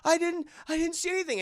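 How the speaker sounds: high, fast stammered denial; acted fear. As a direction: plead innocence in a hurry. Boy denies at knifepoint.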